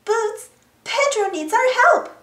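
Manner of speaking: high, urgent character call; shouting. A narrator's reading. Dora calls Boots to rescue Pedro, mildly shouting. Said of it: "Dora shouted".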